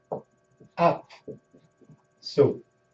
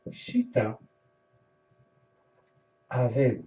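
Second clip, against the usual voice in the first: slow word by word; 3 dB quieter than usual. Shown as a demonstration. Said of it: "The right way to say it is".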